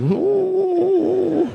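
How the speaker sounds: high sad whimpering ooh; a high, mock-sad ooh; pitiful dog whimper. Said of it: "This is".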